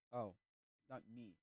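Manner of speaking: low, soft aside; embarrassed realisation. Acted.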